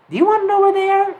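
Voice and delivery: high, fast sing-song question; teasing the kid with a secret; high quick teasing. A narrator's reading.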